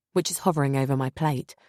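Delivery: usual speaking voice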